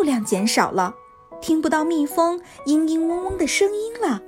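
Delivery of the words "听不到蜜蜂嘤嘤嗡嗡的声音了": ordinary delivery, nothing marked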